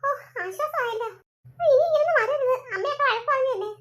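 ordinary delivery

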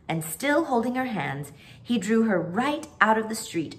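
ordinary delivery, nothing marked

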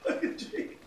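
breathless high laugh through the words. Real, not acted. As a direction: squeeze it out mid-laugh. Laughing at friend in bush.

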